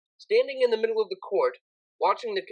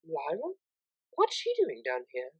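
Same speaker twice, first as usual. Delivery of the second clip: eavesdropper's hushed question; hushed surprise; 2 semitones lower, 6 dB quieter than usual. Acted. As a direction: wonder quietly so no one hears.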